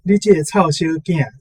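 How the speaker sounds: ordinary talk, nothing marked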